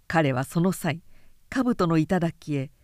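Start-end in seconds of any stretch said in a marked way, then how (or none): none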